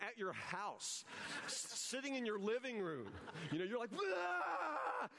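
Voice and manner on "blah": high, wordless holler at the television; mock fan screaming at a game; sports-fan yell. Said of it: "you yell yourself hoarse".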